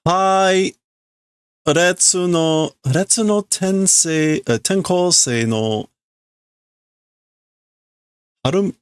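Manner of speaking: transfer student intro. Acted.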